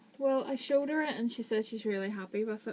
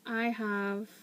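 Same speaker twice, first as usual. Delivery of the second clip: ordinary talk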